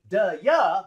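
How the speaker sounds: "da ya" run together on one breath; reduced "duh-yuh" as a single word; linked like one word. Shown as a demonstration. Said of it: "like one word".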